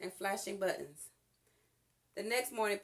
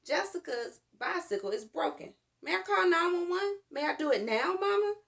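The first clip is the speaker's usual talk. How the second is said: eager; 9 semitones higher, 6 dB louder than usual. A narrator's reading. Child eagerly begging to call.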